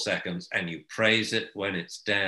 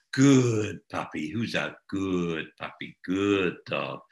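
praising puppy; 3 semitones lower, slower than usual. Shown as a demonstration.

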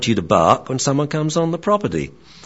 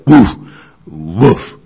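louder half-hearted woofs; reluctant dog bark; 9 dB louder than usual. Retold or imitated. Trainer imitates the reluctant Malamute barking on cue, louder. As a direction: bark twice like a bored Malamute. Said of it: "she would go".

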